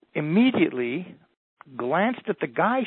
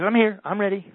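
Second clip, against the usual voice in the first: eager volunteer; 6 semitones higher, 7 dB louder than usual. Retold or imitated. Eager volunteer jumps on stage.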